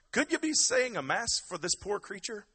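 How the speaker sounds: high, loud hammed-up Irish brogue; Irish accent. Muldoon begs the priest for a mass, Irish accent. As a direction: plead loudly in a put-on Irish lilt. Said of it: "that's my Irish accent".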